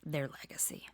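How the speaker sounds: soft whisper on their legacy?. Real, not acted. Speaker to audience, whispering the question's end. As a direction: drop to a whisper.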